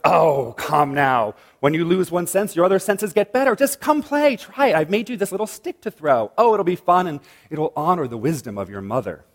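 playful coaxing trickster; sly Trickster coaxing. Acted. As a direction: tempt him like a grinning con man. Trickster coaxing the blind god.

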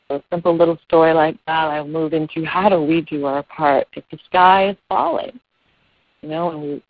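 ordinary talk

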